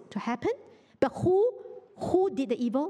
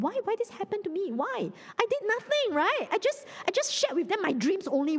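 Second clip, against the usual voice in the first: higher-pitched, aggrieved questioning; acted bewildered protest; 8 semitones higher than usual. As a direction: protest innocence as a baffled Joseph, acted. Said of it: "he doesn't understand".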